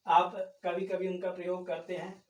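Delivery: ordinary talk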